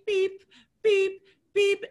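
high, loud electronic beep; high-pitched register beeps, evenly spaced; checkout scanner beep. Retold or imitated. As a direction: imitate a scanner's sharp beeps. Imitates the checkout scanner beeping.

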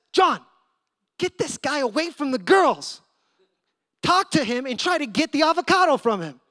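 voiced as a bossy helper calling across; urgent order. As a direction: call out urgent instructions to a helper. Helper urgently ordering John over.

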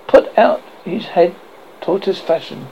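plain reading voice

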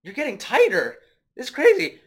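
high, loud hammed-up astonishment; amazed mock shout. Friend retelling movie, voicing the dad in loud amazed shock. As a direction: gasp it out in overdone shock.

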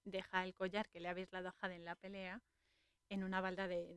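ordinary delivery

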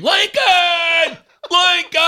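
full-throated, high, drawn-out scream; screamed name. Retold or imitated. Dad screaming for a graduate in a crowd, full-force re-enacted scream. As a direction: bellow the name as loud as you can. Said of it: "And I just screamed".

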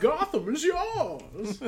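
loud, slow, high villain growl; Bane voice. Shown as a demonstration. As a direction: talk into a cup like Bane. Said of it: "do you wanna know how to do the Bane voice".

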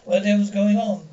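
angry outburst. Acted. Husband finds wife left children.

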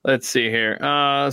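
ordinary talk

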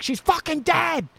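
high, strained, impatient outburst; exasperated yelling. Retold or imitated. Storyteller as Deborah urging Brad to take the ring, high exasperated yell. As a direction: act out her losing patience with him.

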